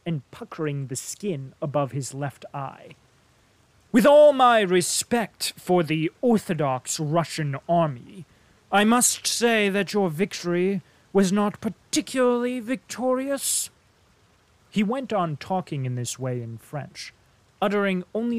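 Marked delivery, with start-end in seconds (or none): 3.9-13.7 contemptuous emphasis. A narrator's reading. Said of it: "a contemptuous emphasis".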